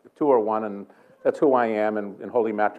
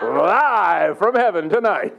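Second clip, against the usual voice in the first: high, loud, drawn-out show opener; mock show announcer; 6 semitones higher, 5 dB louder, much slower than usual. Preacher jokingly opening his meeting like a live show, mock announcer. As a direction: stretch it out like a TV show intro.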